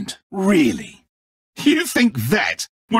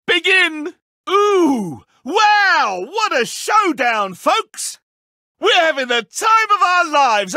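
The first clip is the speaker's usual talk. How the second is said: gleeful game show host; 8 semitones higher, slower than usual. Acted. Villain plays gleeful game host.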